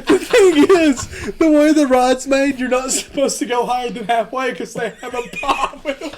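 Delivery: words broken by real laughter; laughing through the story. Real, not acted. Laughing as he tells friends about falling off the ride. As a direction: laugh while telling how you fell off.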